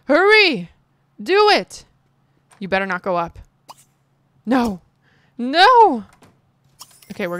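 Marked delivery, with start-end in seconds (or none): 0.0-1.8 high, loud urging shout; frantic yell. Real, not acted. Gamer yelling at her Sim to hurry. 4.4-6.0 high, loud shouted no; panicked no. Real, not acted. Player shouting no at her Sim.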